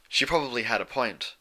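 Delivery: ordinary delivery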